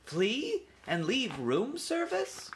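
high, slow, incredulous question; baffled young mouse; bewildered. A narrator's reading. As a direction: stretch it out in disbelief, rising at the end. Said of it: "Ralph was bewildered".

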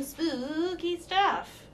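slow, high ghostly drawl; spooky voice. Pretend. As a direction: stretch it out like a ghost story.

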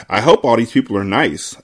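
usual speaking voice

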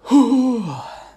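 loud exasperated sigh; big sigh. Real, not acted. Vlogger remembering the creepy ride, sighing loudly. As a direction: let out a heavy sigh. Sigh over Tower of Terror.

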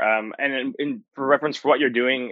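normal talking voice

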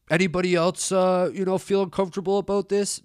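rally the crowd in a hammed-up high voice; mock appeal to the bus.

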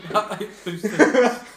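slow words through laughter; laughing. Real, not acted. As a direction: say it while cracking up. Laughing at a joke.

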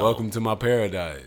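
[name] impression. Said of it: "that's the worst [name] ever heard".

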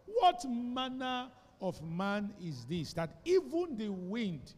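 slow, wondering question; slow, awed quote. Acted. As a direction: marvel at the miracle. Disciples marvel.

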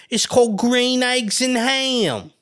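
high, slow title; drawn out. Retold or imitated. Mother naming the book, high and slow. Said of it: "my mother would be calling like".

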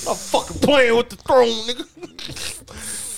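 laughing quote. Retold or imitated. Quoting character, laughing.

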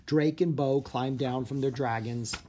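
plain reading voice

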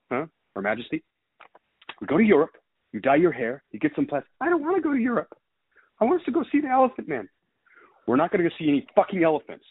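performing a movie scene on request; movie quote performance. Pretend. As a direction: act out a bickering crime family, switching voices. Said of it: "I should do my, my movie quote".

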